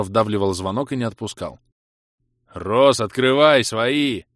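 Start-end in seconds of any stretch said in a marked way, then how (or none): 2.5-4.2 loud drawn-out bellow; roared greeting. A narrator's reading. Visitor demanding to be let in, roaring. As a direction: roar it slowly, stretching the words. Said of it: "проревел такой знакомый голос".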